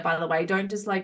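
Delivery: ordinary talk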